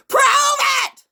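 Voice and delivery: very high, full-force scream; screamed demand. Acted. Speaker demanding proof from accusers, screamed demand. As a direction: scream the challenge at them.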